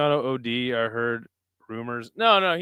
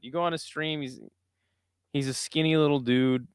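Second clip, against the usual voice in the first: ordinary talk, nothing marked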